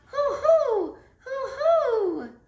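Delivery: very high, loud spooky hoo-hoo; ghostly hooting. Retold or imitated. Little mouse imitating the ghost's hooting, high and loud. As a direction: mimic the ghost's call to scare. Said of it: "It keeps calling".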